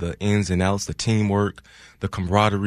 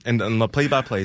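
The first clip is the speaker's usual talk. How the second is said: ordinary talk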